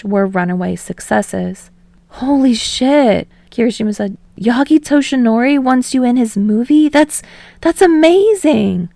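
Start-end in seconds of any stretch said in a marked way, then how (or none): 2.1-3.3 slow, awed exclamation; slow awestruck curse; amazed. Acted. Kirishima amazed. 4.4-8.9 amazed excitement. Acted. Kirishima amazed.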